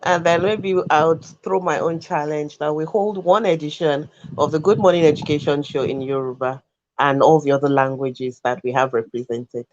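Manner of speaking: ordinary talk, nothing marked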